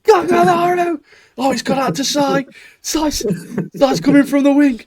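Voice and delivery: high, excited play-by-play, hammed up; mock football commentary. Two mates commentating the anime match, excited commentator. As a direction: call the goal like a TV commentator. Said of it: "Us two commentating it".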